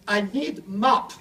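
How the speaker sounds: broken English. Retold or imitated. Traveller telling the info booth worker what he needs, in slow broken English. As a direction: spell out I need mop slowly, word by word.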